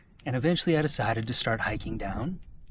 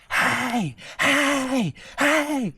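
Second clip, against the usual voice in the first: high, drawn-out imitated scream for attention; retold scream; 13 semitones higher, 5 dB louder, much slower than usual. Hiker imitating someone screaming up the mountain, high drawn-out yell. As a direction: imitate someone yelling "hey" from afar, high and strained. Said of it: "I hear someone start to scream".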